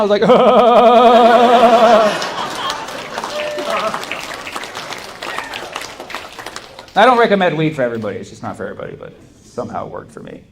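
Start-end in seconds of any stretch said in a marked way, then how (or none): 0.3-6.9 loud high gloating cackle held out; long triumphant laugh. Retold or imitated. Student gloating after solving problem, long loud laugh. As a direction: let out a long victorious laugh.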